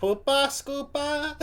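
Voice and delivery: high, slow imitation Geordie; Geordie accent attempt. Viewer copying the comedian, in an attempted Geordie accent. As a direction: stretch it out in a high mock Geordie. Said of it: "I'm doing good on this one".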